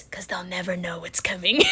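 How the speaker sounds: low, conspiratorial aside; mock-menacing joke; sly mock-sinister. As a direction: drop low like a playful schemer. Joking sly aside about evangelizing.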